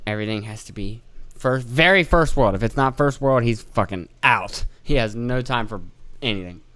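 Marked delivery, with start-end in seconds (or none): none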